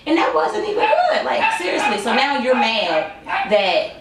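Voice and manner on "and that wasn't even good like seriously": high, laughing scoff at the show. Real, not acted. Reviewer laughing at a cast member's boast, high and giggly. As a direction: laugh it off in disbelief.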